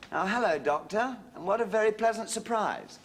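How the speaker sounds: butter up the headmaster; fawning politeness. Acted. Father greeting his son's headmaster on the phone, fawning.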